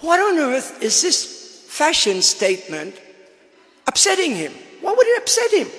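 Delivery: high-pitched incredulous questioning; baffled indignation. Retold or imitated. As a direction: act out bewildered protest, pitch leaping up. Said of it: "I said to myself".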